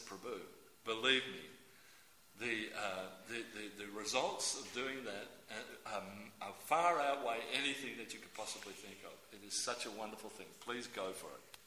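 low, soft, sincere urging; gently persuade, voice dropped low; earnest plea. Retold or imitated. Speaker urging a devotee towards initiation, earnest low plea.

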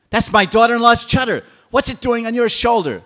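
put-on old woman crying out to the residents, screaming; shrill outcry. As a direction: shout it for all Vrindavan. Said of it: "started crying very loudly".

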